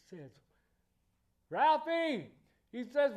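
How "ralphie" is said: a high, loud taunting call; a bully jeering a kid in the snow; mock bully taunt. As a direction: call out to tease him.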